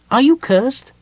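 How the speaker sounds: high, loud, eager question; interested kender. A narrator's reading. Tass questions Gold Moon about her curse, with keen interest. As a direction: ask eagerly in a piping, loud voice. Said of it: "said Tass with interest".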